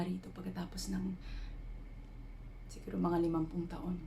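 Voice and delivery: soft, hushed introspection with long pauses; half-whispered, searching confession, left to hang between thoughts; reflective dramatic monologue. Acted. Woman reflecting on life's purpose while climbing a mountain at night, hushed monologue.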